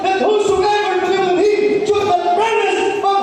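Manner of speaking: high, loud sung-out preaching; fervent preaching. Acted. Pastor exhorts the church members, fervent preaching. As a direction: preach with rising fervour.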